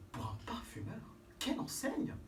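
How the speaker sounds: whispered admiration; admiring whisper. Acted. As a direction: marvel at it in a hush.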